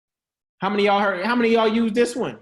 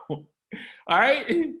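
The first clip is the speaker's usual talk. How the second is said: ordinary talk, nothing marked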